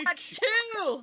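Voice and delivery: high excited squeal; excited shout. Real, not acted. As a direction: squeal with delight. Spots giant pumpkins.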